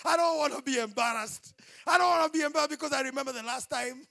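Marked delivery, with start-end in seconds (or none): none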